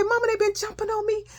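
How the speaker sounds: high, plaintive tattling; reenacted upset complaint. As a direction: wail it to a mother, acted. Upset child tells mother.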